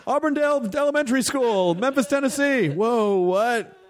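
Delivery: high-pitched imitation of a gushing fan; hammed-up, high fan voice; mock overeager fan. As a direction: blurt it out way up high, as a spoof. Mimics fan reciting personal details.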